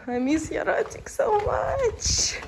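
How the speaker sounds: high, slow tender coo; drawn-out fond coo; squeaky cooing. Real, not acted. Cooing to companion.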